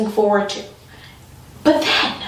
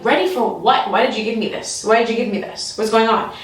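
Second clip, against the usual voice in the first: ordinary talk, nothing marked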